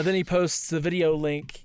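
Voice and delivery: usual speaking voice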